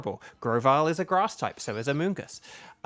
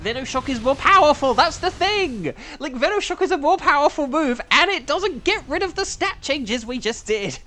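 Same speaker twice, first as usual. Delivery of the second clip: high, loud, fast exasperation; loud, high frustrated explaining; exasperated rant; 10 semitones higher, 5 dB louder than usual. Real, not acted. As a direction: vent about the wasted move.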